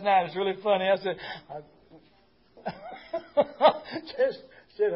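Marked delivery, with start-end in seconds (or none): 2.6-4.1 a high breathy giggle that falls away. Real, not acted. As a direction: giggle at yourself. Laughs before quoting himself.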